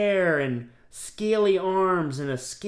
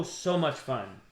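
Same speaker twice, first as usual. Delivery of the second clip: usual speaking voice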